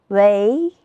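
second-tone "微", drawn out and climbing; tone drill. Shown as a demonstration. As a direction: glide "微" upward, second tone. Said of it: "我们一起读一读".

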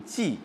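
骑 said jì, with a falling fourth tone; 骑 read as jì. Shown as a demonstration. Teacher explains the noun reading, 骑 said jì. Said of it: "都读骑啊".

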